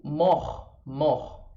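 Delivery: mor ending on R, no T; French mor with open O and throaty R; silent final T. Shown as a demonstration. Teacher showing the silent letter, mor without T. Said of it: "agora o T não é pronunciado".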